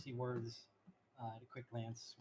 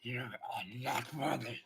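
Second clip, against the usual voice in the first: loud commanding wolf guard; 6 dB louder than usual. Acted. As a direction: order them off like a beast. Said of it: "that's a terrible voice I'm doing".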